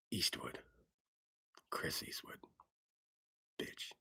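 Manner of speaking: low, whispered tough-guy rasp; low whispered Eastwood impression; gravel tough-guy voice. Shown as a demonstration. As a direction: growl it like a movie hard man. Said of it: "talk like this".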